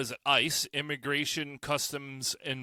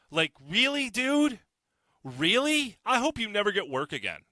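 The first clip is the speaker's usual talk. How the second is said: incredulous outrage; 7 semitones higher than usual. Real, not acted. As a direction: scoff in disbelief, pitch jumping up. Outraged at comedian's tweet.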